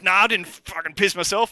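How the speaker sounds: high, loud defensive protest; indignant denial. Acted. Teased guard to his jeering mates, indignant. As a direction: protest hotly at being teased.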